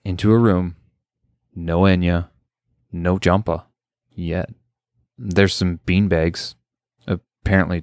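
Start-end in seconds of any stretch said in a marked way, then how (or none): none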